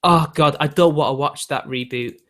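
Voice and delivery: normal talking voice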